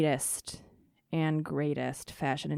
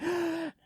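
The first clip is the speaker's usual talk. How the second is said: mock gasp; 10 semitones higher, 5 dB quieter than usual. Surprised by reporter's question.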